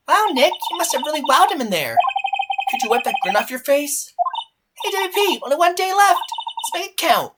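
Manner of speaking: high, upbeat sidekick voice; rally them in a high, eager voice; perky girl assistant. Acted. Maya teases Nick and rallies the client, perky.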